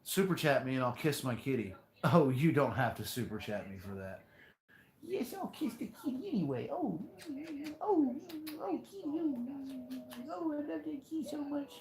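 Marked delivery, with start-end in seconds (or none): 5.0-11.7 very high, soft cooing pet voice; baby talk to cat. Acted. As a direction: coo at the kitty like a baby.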